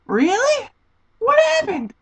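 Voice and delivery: very high, squeaky disbelief; hammed-up astonished squeal; incredulous. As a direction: react in high-pitched exasperated disbelief. Acts out her disbelief at clerk.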